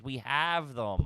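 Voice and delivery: very slow, mildly scolding; slow scolding janitor. Retold or imitated. Janitor lecturing him about using a bag, slow and deadpan. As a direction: drag out the advice like a tired janitor. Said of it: "He goes".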